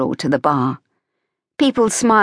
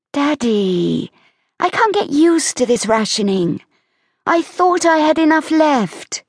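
put-on much higher girlish voice, apologetic; Evie, coaxing daughter; 10 semitones higher than usual. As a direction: wheedle Daddy out of it. Evie excuses herself to Daddy.

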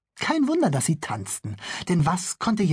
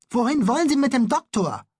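put-on worried boy; 9 semitones higher than usual. Bülle confronts the police.

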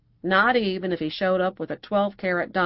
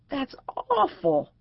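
slow, drawn-out gasp of dismay; aghast friend drawing the words out slowly; shocked; 11 semitones higher, much slower than usual. Acted. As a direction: stretch it out, appalled. Shocked friend hears the story.

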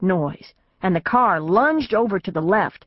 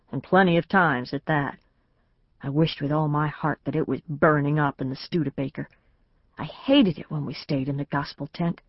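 plain reading voice

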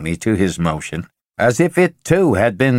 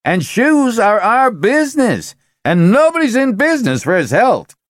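higher, louder, still worked up; raised voice; 12 semitones higher, 3 dB louder than usual. Acted. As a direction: keep the pitch up and push. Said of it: "his voice rising".

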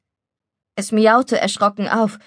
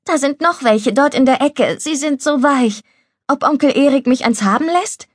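higher, lightly voiced little girl; pleading child lifting into a question; young girl; 6 semitones higher than usual. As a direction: lightly voice an eager small girl.

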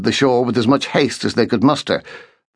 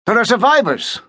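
raised, pointing out survivors; Markham urging; 12 semitones higher than usual. A narrator's reading. Markham calls to the midshipman, urgent. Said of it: "said Markham".

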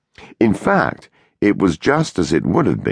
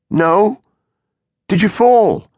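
high urgent question; worried and loud; anxious; 5 dB louder, slower than usual. A narrator's reading.

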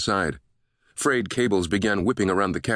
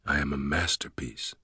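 low, softer inner voice; softer, lower private thought; hushed self-admiration; 4 semitones lower, 5 dB quieter than usual. A narrator's reading. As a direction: savour it quietly to yourself. Villain's gloating private thought.

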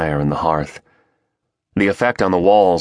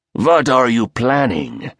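raspy voice; 6 semitones higher than usual. Acted. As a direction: rasp the question slowly. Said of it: "the raspy voice asked".

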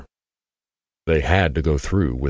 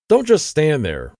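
much higher, louder sharp order; commanding; 10 semitones higher, 4 dB louder than usual. A narrator's reading. Regina ordering Veronica to help, commanding loud voice. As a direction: bark it as an order. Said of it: "Regina commanded".

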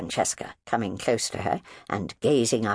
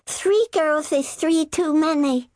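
put-on very high, slow, loud little girl; child announcing; 5 dB louder, slower than usual. Youngest girl repeating her daddy, slow and solemn. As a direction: pronounce it solemnly like a small child. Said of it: "announced Francesca".

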